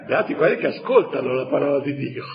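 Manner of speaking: mock solemn. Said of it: "cioè gli dice".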